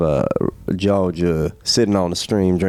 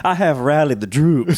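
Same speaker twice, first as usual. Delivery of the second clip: slightly higher, hammed-up Southern command; rally them like a Confederate officer; a hint of a Southern general; mock southern general; 5 semitones higher than usual. Southern general role play.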